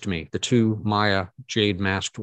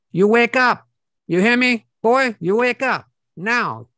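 put-on high, loud, scolding old grandmother voice, much higher; Filipino grandmother; 7 dB louder than usual. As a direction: bark her awake like a fierce Filipino granny, slowing at the end. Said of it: "a voice almost like a Filipino grandmother".

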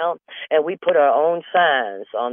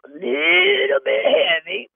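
coy squeaky understatement; 11 semitones higher, much slower than usual. Retold or imitated. Coy self-description.

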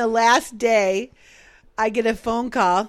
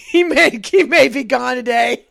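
louder, half laughing half crying; laughing through tears; 8 dB louder than usual. Real, not acted. Retelling the boss's verdict on her worker, laughing through tears. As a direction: let the laugh crack the words. Bosses react, she starts crying.

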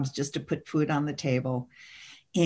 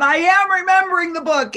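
high, loud snapped reply; high and loud, annoyed; irritated retort; 11 semitones higher, 7 dB louder than usual. Retold or imitated. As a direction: snap back impatiently. Snapping back at God's impulse.

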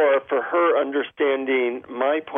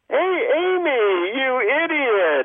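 fond teasing insults; 14 semitones higher than usual. Shown as a demonstration. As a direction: insult her sweetly like you missed her. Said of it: "my tone of voice is showing, like, I missed you".

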